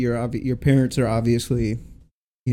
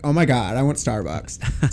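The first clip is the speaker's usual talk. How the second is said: put-on drawn-out Orange County California girl accent; slower than usual.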